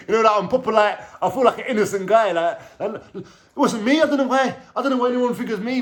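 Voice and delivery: laughing, high mock protest of innocence; typical innocent prisoner. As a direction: ham up the wronged inmate who swears it wasn't him. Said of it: "I'm sounding like the typical, typical prisoner".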